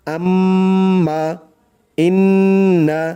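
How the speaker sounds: আম্মা, ইন্না with the doubled m and n held and hummed through the nose; nasal ghunnah. Shown as a demonstration. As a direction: hum the doubled consonant long. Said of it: "টান দিয়ে গুন্নাহ করে পড়তে হবে".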